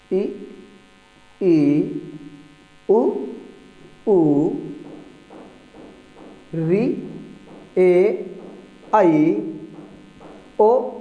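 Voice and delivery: each vowel sung out slowly, one by one; reciting the vowels. Shown as a demonstration. Teacher reciting the eleven vowels to students, drawn out. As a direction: call each vowel clearly for the class. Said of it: "कौन कौन से हैं?".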